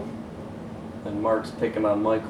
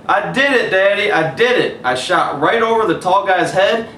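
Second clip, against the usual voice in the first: put-on high, louder joyful boy; excited shout; 9 semitones higher, 8 dB louder than usual. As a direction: burst in bragging to daddy. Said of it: "he shouted".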